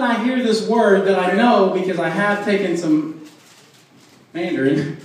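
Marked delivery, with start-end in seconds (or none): none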